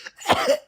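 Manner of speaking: short breathy cough from the smoke. Acted. Kids caught in the thick smoke, a small cough. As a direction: cough once, choked by smoke. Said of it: "ce qui les fait tousser".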